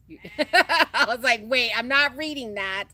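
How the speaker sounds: loud, high laugh breaking through; laughing almost to tears. Real, not acted. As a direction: crack up, refusing to read it. Laughing at a comment.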